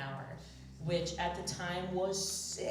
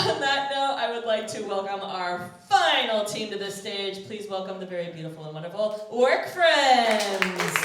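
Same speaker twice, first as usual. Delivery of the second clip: stage announcer; 3 semitones higher, 11 dB louder than usual. Acted. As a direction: announce the team to the crowd. Host introduces final team.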